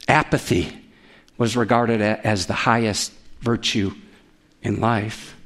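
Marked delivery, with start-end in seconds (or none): none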